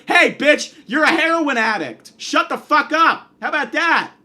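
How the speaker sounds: fired-up, heated telling-off; angry confrontation. Acted. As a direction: shout down someone you are sick of. Acted telling-off of absent celebrity.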